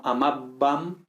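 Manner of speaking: "amabam" with a hard stopped B; fully closed B. Shown as a demonstration. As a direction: shut the lips completely on the B of "amabam". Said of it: "Con esta B cerrando totalmente los labios".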